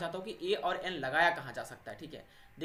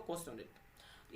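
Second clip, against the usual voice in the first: soft, slow, breathy whisper of the answer; 4 semitones lower, 16 dB quieter, much slower than usual. Real, not acted. Teacher answering his own quiz question in a slow whisper. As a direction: drop to a whisper to give the answer.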